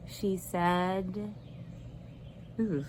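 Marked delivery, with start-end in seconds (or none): none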